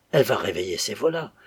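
ordinary delivery

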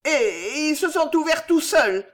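high, brazen desperation; desperate aplomb. A narrator's reading. Old woman lies that letters opened by themselves, desperately brazen. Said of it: "avec l'aplomb du désespoir".

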